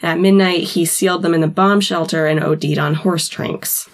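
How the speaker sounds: ordinary delivery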